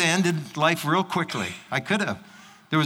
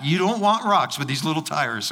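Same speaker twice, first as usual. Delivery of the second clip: ordinary delivery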